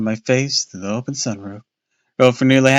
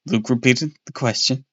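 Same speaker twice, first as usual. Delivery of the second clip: ordinary delivery, nothing marked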